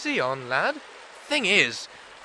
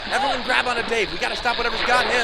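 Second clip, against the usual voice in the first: higher, louder urgent shout; 6 semitones higher, 5 dB louder than usual. Acted. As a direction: yell orders in the struggle. Struggling to pull friend back.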